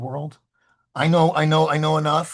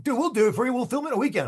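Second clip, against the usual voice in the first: higher, lightly voiced eager buddy; mock eager friends; 10 semitones higher, much faster than usual. Friends offering to film it, lightly mimicked higher. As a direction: play the pushy friends offering help, pitched up. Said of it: "I had friends who were like".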